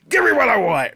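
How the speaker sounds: hammed-up demanding yell; mock wrestler yell. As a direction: bellow it as a jokey impression. Imitating Batista's catchphrase, laughing.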